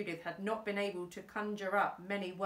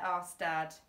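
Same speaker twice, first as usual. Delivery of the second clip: ordinary delivery, nothing marked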